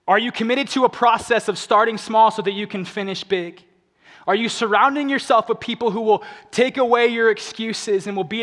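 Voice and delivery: rally the crowd with rising force; fired-up keynote delivery; motivational preaching. Acted. Motivational speech at full force.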